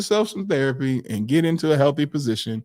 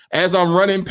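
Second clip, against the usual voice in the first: ordinary talk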